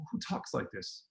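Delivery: unvoiced, incredulous whisper to yourself; whispered thought. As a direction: whisper it like a private aside.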